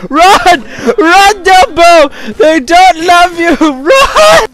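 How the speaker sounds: high, loud cheering yell; excited shouting. Real, not acted. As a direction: yell him on at full volume. Cheering the elephant on.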